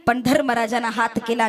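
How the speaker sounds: ordinary delivery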